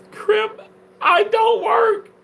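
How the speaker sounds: high, slow whine; whiny falsetto. Acted. High whiny reply to viewer.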